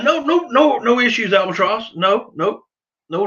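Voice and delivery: ordinary talk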